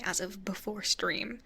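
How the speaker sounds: ordinary talk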